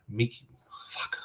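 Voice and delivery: whispered under breath; whispering. Real, not acted. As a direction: mutter it in a whisper. Whispering to himself, forgot name.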